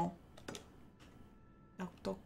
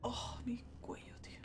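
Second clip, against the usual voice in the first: whispering; 13 dB quieter than usual. Real, not acted. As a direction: breathe it out under your breath. Complains about his neck.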